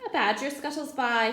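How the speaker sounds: plain reading voice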